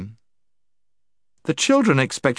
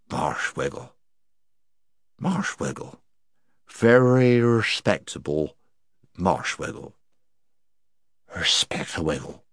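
put-on drunken mumble; 3 semitones lower, 3 dB quieter than usual. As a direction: repeat it drunkenly, lost in thought. Drunk Puddleglum repeating.

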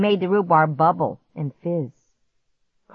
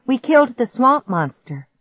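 proud; 7 semitones higher, 6 dB louder than usual. A narrator's reading. Repeats triumph to dog.

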